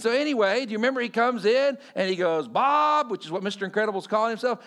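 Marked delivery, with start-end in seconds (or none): 2.5-3.1 lightly voiced, higher-pitched weaselly boss. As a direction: call out like an irked little boss, half imitated. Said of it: "the ultimate Weasley voice".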